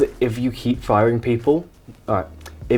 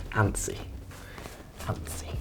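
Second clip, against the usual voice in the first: softer and softer, almost gone; nervous whisper; 12 semitones lower, 17 dB quieter than usual. Real, not acted. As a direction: whisper the word, barely audible. Admits nerves about the whistleblower.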